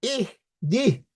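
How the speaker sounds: اہدی said slowly as ihdī; slow demonstration of اہدی; careful pronunciation. Shown as a demonstration. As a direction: pronounce اہدی with a clear h. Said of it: "یہ اہدی کا لفظ".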